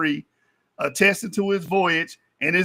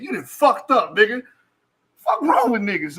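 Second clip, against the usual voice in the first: usual speaking voice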